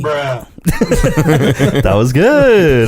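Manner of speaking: high-pitched, stretched words through laughter; real laughing. Real, not acted. Host delighted by co-host's sound-button gag, laughing. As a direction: crack up while praising the gag.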